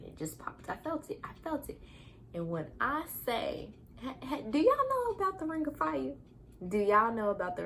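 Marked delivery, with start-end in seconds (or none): 0.1-1.8 whispered, higher and soft; hushed whisper. Retold or imitated. Mother recalling the tear, awed whisper. Describing tearing during birth. 4.0-6.1 high, laughing question to viewers; giddy laugh-in question. Acted. Vlogger teasing viewers about the Ring of Fire, giddy high voice.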